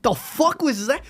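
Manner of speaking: high-pitched mock outrage; drawn-out, high mock disbelief; Tommy. Acting out Tommy's bullying reaction.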